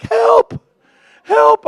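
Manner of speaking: high, loud mock cry for help; hammed-up panic, calling out; mock panicked cry. Host acting out his panicked texts, high mock cries. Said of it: "got texts from me like".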